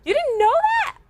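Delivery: high, loud incredulous yell; loud sharp voice. Real, not acted. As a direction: shout it in disbelief. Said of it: "No, stop yelling at me".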